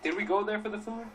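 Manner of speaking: retold question, pitched well up; incredulous retort. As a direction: ask it high and disbelieving, imitating yourself.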